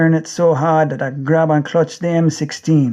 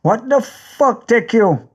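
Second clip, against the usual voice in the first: much higher, slow angry bark in another man's voice; Weeper's angry voice; 7 semitones higher, slower than usual. Acted. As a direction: snap at your crazed friend, slowly. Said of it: "Weeper ... say".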